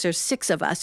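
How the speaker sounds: plain reading voice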